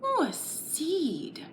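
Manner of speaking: normal talking voice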